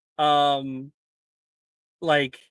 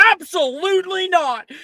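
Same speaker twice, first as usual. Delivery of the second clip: high, loud indignant cry; outraged refusal; 14 semitones higher, 6 dB louder than usual. Acted. Player refusing the doom prophecy, outraged high cry.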